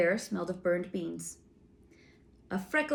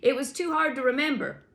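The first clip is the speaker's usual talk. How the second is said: high sentry voice; 7 semitones higher, 5 dB louder, faster than usual. A narrator's reading. Sentry explains cheerfully.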